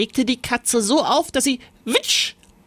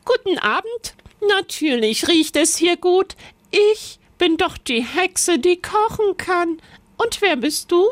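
high, chirpy witch; a cheery high cook witch; 9 semitones higher than usual. A narrator's reading. The cooking witch greets Lisbeth, high and chirpy.

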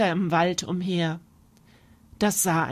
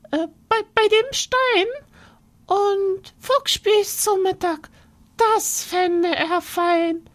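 mouse spinning a scary tale, much higher; boastful little mouse; 11 semitones higher than usual. Acted. Mouse scaring the fox with the monster, high and sly.

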